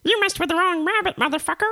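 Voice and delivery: squeaky villain delivering a threat; high-pitched menacing rabbit. Acted. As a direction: threaten in a tiny, very high cartoon voice. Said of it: "He just talks like this".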